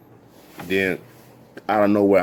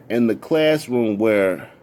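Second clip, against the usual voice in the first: usual speaking voice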